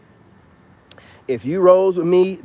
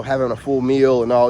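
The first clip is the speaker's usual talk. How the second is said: ordinary talk, nothing marked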